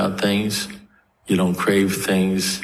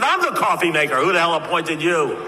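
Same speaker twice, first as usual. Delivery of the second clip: worked-up, high-pitched territorial protest; indignant retort; 8 semitones higher than usual. Retold or imitated. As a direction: snap back as if your turf is invaded. Acted indignant retort in story.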